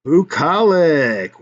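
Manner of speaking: "bucolic" said very slowly as "boo-KOL-ik"; word announced. Shown as a demonstration. "bucolic" presented to viewers as word of the day, slow. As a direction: drawl out "bucolic" syllable by syllable. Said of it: "It's an adjective".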